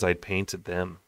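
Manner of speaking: ordinary delivery, nothing marked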